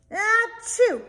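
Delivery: loud, high explosive achoo; huge sneeze. Acted. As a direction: let rip a giant sneeze. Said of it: "I sneezed a great sneeze".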